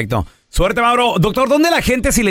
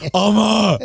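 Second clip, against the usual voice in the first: put-on little boy voice calling his mom; mock child calling out to his mother; kid voice; much slower than usual. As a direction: squeeze the voice like a seven-year-old. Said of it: "Así era, era así".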